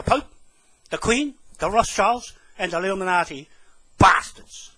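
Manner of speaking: loud burst of contempt; angry curse. Real, not acted. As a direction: spit the insult out loud. Cursing those blamed in rant.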